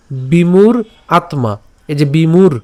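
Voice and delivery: বিমূঢ় with a retroflex ঢ়, split from আত্মা; বিমূঢ় clearly articulated; careful pronunciation. Shown as a demonstration. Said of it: "ঢ শিন্ন র পর্যন্ত".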